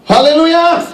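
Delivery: high, loud praise cry; rallying shout. Real, not acted. Preacher calling the congregation to praise, rallying shout.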